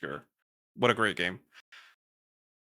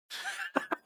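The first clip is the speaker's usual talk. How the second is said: breathy laugh; 8 dB quieter than usual. Real, not acted. Laughs at Italian accent suggestion.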